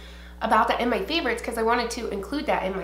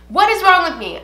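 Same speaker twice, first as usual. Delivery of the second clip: exasperated; 8 semitones higher, 10 dB louder than usual. Real, not acted. Exasperated at self.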